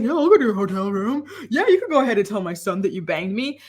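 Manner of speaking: ape her eager yes, rushed and careless; mocking the mom. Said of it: "She's like".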